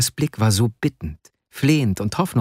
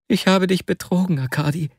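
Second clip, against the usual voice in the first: put-on higher, pleading young friend's voice; distraught Wasja, confessing; 8 semitones higher than usual. Wasja confesses to his friend in tears, distraught. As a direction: confess in a high, anguished voice.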